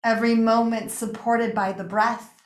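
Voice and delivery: calm meditation guide with long pauses between phrases; unhurried, settling yoga-nidra voice; guided meditation. Real, not acted. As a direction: guide them gently into rest, phrase by phrase. Leads a guided relaxation.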